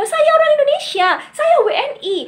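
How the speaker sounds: high, loud and proud; proud declaration. Acted. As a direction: declare it proudly to the whole world. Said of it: "dengan bangga mengatakan kepada seluruh dunia".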